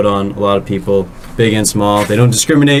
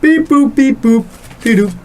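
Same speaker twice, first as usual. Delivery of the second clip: much higher, beeping toy robot; slower than usual. Pretend. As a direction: chirp it like a little toy robot. Robot noises before the ad.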